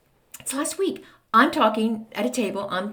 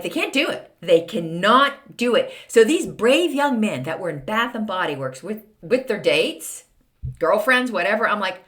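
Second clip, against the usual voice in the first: ordinary talk, nothing marked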